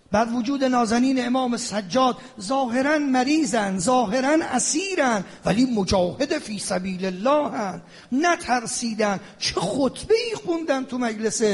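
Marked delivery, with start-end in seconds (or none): none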